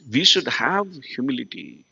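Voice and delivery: ordinary talk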